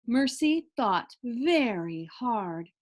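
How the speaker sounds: usual speaking voice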